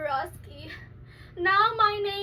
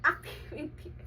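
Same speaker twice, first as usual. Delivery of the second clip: activity breathed through a giggle; laughing whisper; 4 semitones lower, 7 dB quieter than usual. Shown as a demonstration. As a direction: repeat the word as a whispered laugh.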